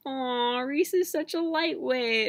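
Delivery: playfully ribbing him, drawn out; teasing. Retold or imitated. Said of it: "she's like".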